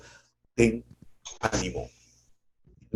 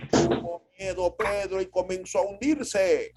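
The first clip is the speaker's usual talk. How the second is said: ordinary talk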